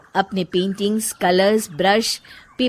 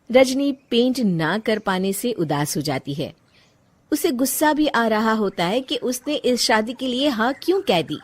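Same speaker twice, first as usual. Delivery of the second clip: usual speaking voice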